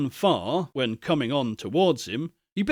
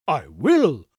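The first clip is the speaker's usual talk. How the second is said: put-on a louder, eager old gentleman's promise; cried out; 3 semitones lower, 5 dB louder than usual. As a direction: call it out gladly. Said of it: "cried the old gentleman".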